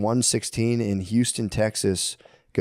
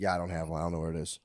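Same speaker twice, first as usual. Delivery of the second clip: much softer, lower helpless mumble; defeated mumble; 7 semitones lower, 6 dB quieter, faster than usual. Retold or imitated. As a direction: admit it quietly, beaten. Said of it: "It's like".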